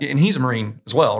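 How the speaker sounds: normal talking voice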